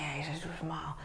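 hushed aside under the breath; whispered thought. Retold or imitated. Vlogger whispering her thought at a TikTok comment. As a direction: whisper it to yourself, exasperated.

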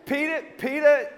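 usual speaking voice

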